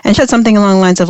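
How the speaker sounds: ordinary delivery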